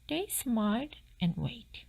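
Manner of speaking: ordinary delivery, nothing marked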